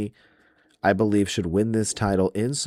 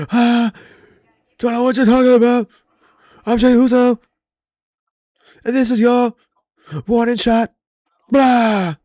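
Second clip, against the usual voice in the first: much higher and louder mock yelling promo; mock Jey Uso yell; 10 dB louder than usual. Fan mocking the wrestler's promo as a loud hammed-up yell. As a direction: ham up a winded wrestler screaming a promo. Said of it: "he comes down there and yells and is like".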